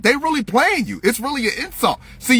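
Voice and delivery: ordinary talk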